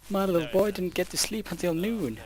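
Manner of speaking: high whiny mother voice; mocking mother. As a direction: play a fussing mum. Mocking recruit's worried mother.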